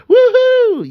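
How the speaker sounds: high loud woohoo; kids cheering. Retold or imitated.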